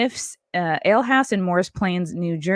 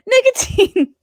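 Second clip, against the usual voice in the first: much higher, hammed-up cry of nicotine; mock alarm; 4 dB louder, slower than usual. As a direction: yelp the word like a fake scare. Mock alarm at own disclaimer.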